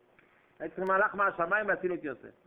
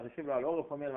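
usual speaking voice

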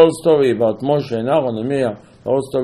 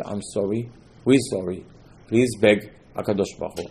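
lower, softer pleading; imitated contrition; 5 semitones lower, 4 dB quieter than usual. Bitten people begging Moshe to pray, mock contrite. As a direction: plead humbly, as if chastened.

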